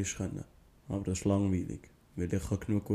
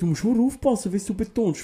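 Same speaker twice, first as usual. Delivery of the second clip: much higher, louder insistence; emphatic warning; 11 semitones higher, 9 dB louder than usual. Real, not acted. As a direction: hammer home the warning. Warning about tones, raised. (eindringliche Warnung, viel höher und lauter)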